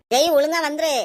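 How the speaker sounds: put-on loud warning to a child; stern call. Mother ordering son back from other room, stern.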